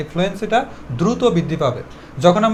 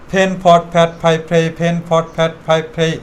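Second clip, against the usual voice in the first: evenly chanted drill, puffed P on each word; in one breath. Shown as a demonstration. Teacher shows students the one-breath practice, run together. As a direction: run the word list without a pause. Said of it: "একদমে বিশ বার তোমাকে এইটা practice".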